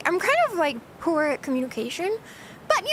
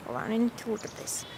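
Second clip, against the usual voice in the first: normal talking voice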